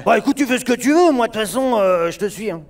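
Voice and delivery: high, loud anime bad-boy voice; Bakugo voice. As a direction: play the hothead anime rival. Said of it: "Bakugo aussi".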